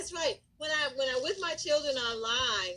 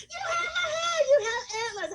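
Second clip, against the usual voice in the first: put-on kids exclaiming; 14 semitones higher, 3 dB louder than usual. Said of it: "They goes".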